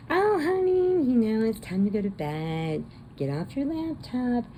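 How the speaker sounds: high, sing-song mother; sweet mom voice. Retold or imitated. Mom telling daughter to get off laptop, high sweet voice. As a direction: coo it like a doting mom.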